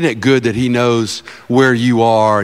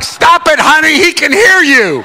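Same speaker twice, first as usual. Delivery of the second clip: high, loud mock wifely plea; scolding wife; 13 semitones higher, 7 dB louder than usual. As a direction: imitate a wife urgently shushing her husband. Acts wife scolding him.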